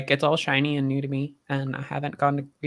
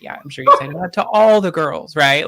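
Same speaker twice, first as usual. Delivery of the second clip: louder, playful mock-skeptical jab; teasing retort; 7 dB louder than usual. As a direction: tease the coworker for his excuse, louder. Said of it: "And I was like".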